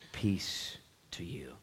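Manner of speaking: soft, slow whispered peace to you. Acted. Pastor whispering Jesus's greeting, slow and soft. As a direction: breathe it out gently.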